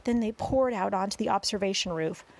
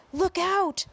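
high, loud warning shout; alarmed cry; 10 semitones higher, 5 dB louder than usual. A narrator's reading. Policemen and firemen see the monstrous face, alarmed shout. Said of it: "they cried".

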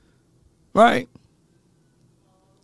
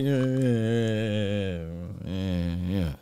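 very soft, low trailing yeahs; hushed, half-hearted "yeah"; mock muted reaction; 9 semitones lower, 13 dB quieter, much slower than usual. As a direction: barely voice it, like an unimpressed crowd. Imitates everyone's reaction to the lady.

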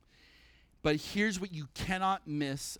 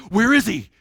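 much louder, a hunter's call; urgent search; 4 semitones higher, 11 dB louder than usual. Retold or imitated. Roman soldiers hunting the rebel leader, calling out loud. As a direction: shout it out looking for him.

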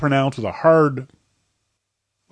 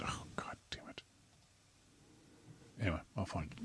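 whispered aside to himself; whispered muttering; 6 semitones lower, 26 dB quieter, faster than usual. Real, not acted. Host muttering to himself while searching, whispered. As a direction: mutter while hunting for the page.

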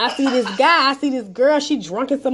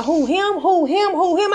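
higher-pitched, repetitive "who, him?" mimicry; mimicked clueless questioning; 6 semitones higher, slower than usual. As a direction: imitate a clueless friend asking over and over. Mimics clueless friend pointing around.

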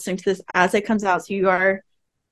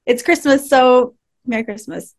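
usual speaking voice

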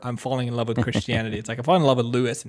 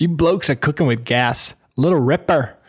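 playful mock Australian, higher pitched; 4 semitones higher, 4 dB louder than usual. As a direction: relish the slang in a hammed-up Australian voice. Gleefully repeats Australian slang review.

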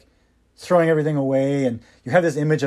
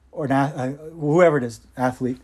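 ordinary delivery

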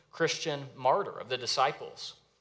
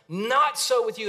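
"Not" hit high and loud, the rest falling; ringing restatement of the key line; emphatic repeat; 8 semitones higher, 7 dB louder than usual. Shown as a demonstration. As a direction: proclaim "Not so with you" to the whole room. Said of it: "Underline that sentence".

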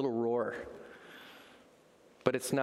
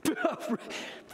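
high hyena laugh; mocking hyena cackle. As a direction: cackle at the little cub. Hyenas mock the cub.

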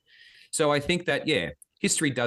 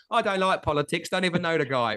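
a hint of Cockney, high and puzzled; mock Cockney; 7 semitones higher than usual. Podcaster voicing a clueless Roman citizen, in light mock Cockney. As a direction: play an indifferent Cockney Roman who never heard of Caesar. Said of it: "talking Cockney English in ancient Rome".

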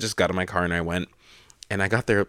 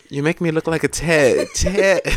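laughing through a high, loud "You make me look like a tit"; broken up with laughter; laughing Irish line; 8 semitones higher, 5 dB louder than usual. Retold or imitated. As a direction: giggle while repeating it. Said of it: "and I was like".

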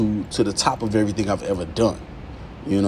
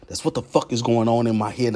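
ordinary talk, nothing marked

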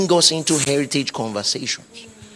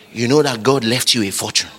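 usual speaking voice

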